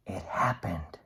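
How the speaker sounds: hushed dramatic whisper; storyteller's secret whisper; suspense whisper. A narrator's reading. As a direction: drop to a whisper to build suspense.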